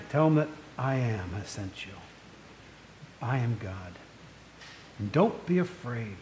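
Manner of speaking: low, hushed, solemn God speaking from the bush. Acted. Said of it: "What does God say through the bush?".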